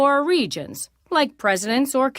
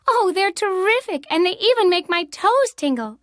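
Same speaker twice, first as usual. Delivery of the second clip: delighted; 5 semitones higher than usual. A narrator's reading. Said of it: "I declared with delight".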